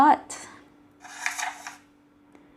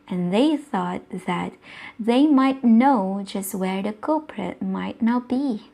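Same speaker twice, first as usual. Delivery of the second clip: ordinary delivery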